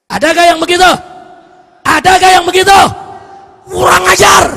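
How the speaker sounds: loud, high preacher's shout; full-force scolding. Real, not acted. Preacher condemning disrespectful youths, outraged shouting. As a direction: roar indignantly at the congregation.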